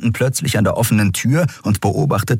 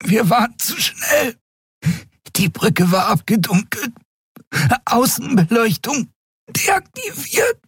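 high, strangled fragments with gasps; breathless, throttled explanation; choked out. A narrator's reading. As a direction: squeeze out short phrases while choking. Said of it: "brachte sie erstickt hervor".

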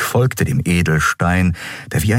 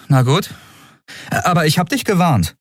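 higher, wry warning; reluctant warning; 10 semitones higher than usual. Acted. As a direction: give in but warn him. Endriel warns Kero.

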